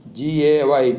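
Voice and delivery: usual speaking voice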